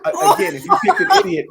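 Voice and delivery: high and loud through laughter; laughing retort. Real, not acted. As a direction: push the point while cracking up.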